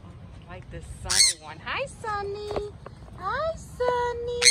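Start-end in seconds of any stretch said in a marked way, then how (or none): 1.6-4.4 high, drawn-out cooing hello; pet-greeting voice. Real, not acted. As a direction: greet a little bird, high and slow. Greeting a bird.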